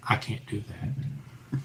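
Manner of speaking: breathy unvoiced whisper, slow. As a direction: hush it out, barely a breath. Answering possessed wife.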